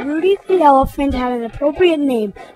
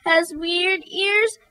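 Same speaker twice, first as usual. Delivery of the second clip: higher voice stumbling through tears; weeping; 6 semitones higher than usual. Acted. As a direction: stammer it out while weeping. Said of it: "Weeps and all".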